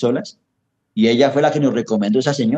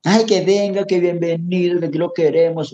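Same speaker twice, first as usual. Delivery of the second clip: higher-pitched mock of over-sweet hosts; imitating a fake warm welcome, higher; mock fawning welcome; 6 semitones higher than usual. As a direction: parody their syrupy welcome, pitched up. Mimics fawning Ecuadorian hosts.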